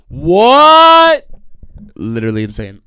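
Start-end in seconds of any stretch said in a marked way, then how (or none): 0.1-1.3 long, high, rising yell; incredulous shout. Real, not acted. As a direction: scream "what?" in outraged disbelief. Outraged that insult got back to him.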